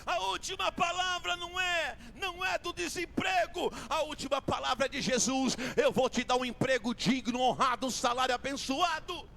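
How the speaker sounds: rising, forceful pulpit preaching over music; preaching at full force. Acted. Preacher to the church, rousing preaching. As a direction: build the crowd up with each repetition.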